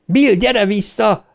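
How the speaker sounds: high, drawn-out call; slow, high-pitched cry. A narrator's reading. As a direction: call someone back from a distance. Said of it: "kiáltotta Ricsi".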